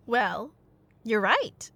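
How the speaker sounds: ordinary delivery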